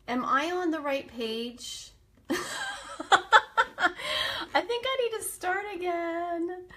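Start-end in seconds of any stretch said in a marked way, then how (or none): 2.1-6.6 laughing through the words. Real, not acted. Host laughing at her tech trouble, higher pitch. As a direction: giggle at your own mix-up.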